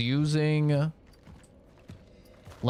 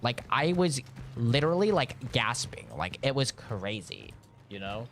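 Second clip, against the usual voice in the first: exaggerated gossip voice with stretched words; mocking Kenzie. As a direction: make fun of a breathless-gossip storyteller, dragging the key words. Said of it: "when I'm making fun of Kenzie, being like".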